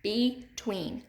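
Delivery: "Between" split into be, then tween; teacher sounding out "Between" in two separate beats; syllable by syllable. Shown as a demonstration. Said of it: "Say it, put your hand under your chin".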